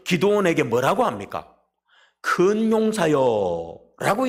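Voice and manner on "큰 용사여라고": very slow, stretched call with a lift on 큰; slow, grand address; drawn-out solemn call. Acted. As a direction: call it out slowly like a proclamation. God calls Gideon mighty warrior.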